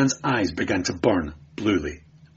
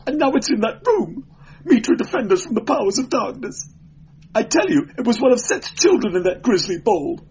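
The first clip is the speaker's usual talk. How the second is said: high, shrill, fast panic; hysterical shrill; 5 dB louder than usual. Acted. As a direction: babble it in terror. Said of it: "voice shrilled and cracked with hysterical excitement".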